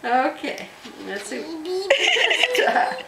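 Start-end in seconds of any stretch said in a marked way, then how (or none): 2.5-3.0 high, loud laughing plea; begging through laughter. Real, not acted. Mother laughing, asks child to stop.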